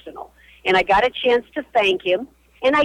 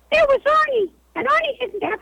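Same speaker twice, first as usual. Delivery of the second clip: very high-pitched DJ imitation; Arnie. Guest introduces her childhood radio hero, imitating his high voice. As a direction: put on a comically high voice. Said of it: "He had a voice like this".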